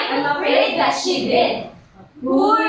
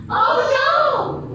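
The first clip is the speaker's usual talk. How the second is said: a sudden high "Oh no!"; startled cry; 12 semitones higher than usual. Acted. Girl caught by a thorn, startled high cry. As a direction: yelp as the thorn catches.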